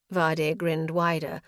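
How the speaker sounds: usual speaking voice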